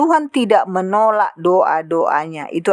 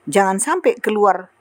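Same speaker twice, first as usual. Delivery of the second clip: ordinary talk, nothing marked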